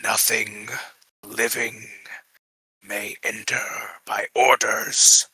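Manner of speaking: slow, hollow ghostly warning; a ghost warning from the halls. Acted. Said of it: "you hear a ghostly voice reverberate through the halls".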